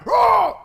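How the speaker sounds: a loud, high startled shout; mock roar. Dad bursting in on the family with a loud cry. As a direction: belt out a short angry yell.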